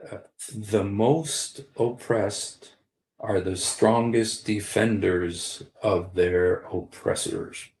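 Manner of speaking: slow, weighty delivery of the phrase; slow emphatic maxim. Shown as a demonstration. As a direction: lay each word down slowly like a maxim. Repeating his key phrase.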